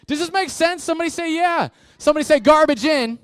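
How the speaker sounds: high, loud call-out; rally the crowd. Real, not acted. Pastor leads a call and response, loud and high.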